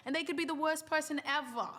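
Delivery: mock proper British accent; posh British accent. Streamer on how British people come across, posh British accent. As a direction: imitate a very proper Brit, stretching the words. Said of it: "they're just so posh and they're so proper".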